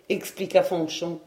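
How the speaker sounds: ordinary delivery, nothing marked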